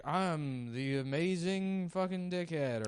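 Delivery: mock showman intro, drawn out. Mocking the hypnotist's stage intro.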